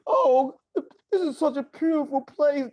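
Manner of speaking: high, whimpering sing-song praise; mock gushing. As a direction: swoon over the place, overdone. Mock praise before the storm.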